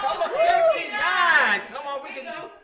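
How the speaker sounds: will the number to come in; excited urging. Real, not acted. Urging the ticker on.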